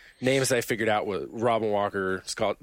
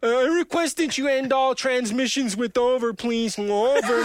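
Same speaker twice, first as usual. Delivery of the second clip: put-on much higher, stiff radio-protocol kid voice; goofy high voice; 11 semitones higher, 3 dB louder than usual. Gamer trolling his team in a high voice to say over. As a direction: request it like a squeaky officious cadet.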